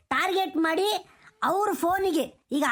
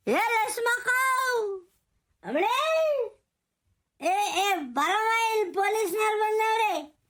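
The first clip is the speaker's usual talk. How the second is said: calling out; 5 semitones higher, slower than usual. Acted. Calling Lakshmakka from afar.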